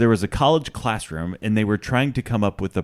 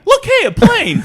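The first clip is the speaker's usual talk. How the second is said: much higher, laughing squeal over a bird; overexcited bird spotter; 8 dB louder, slower than usual. Pretend. Mocking the cartoon guy, squealing with laughing excitement. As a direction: gasp at the bird like it's a miracle.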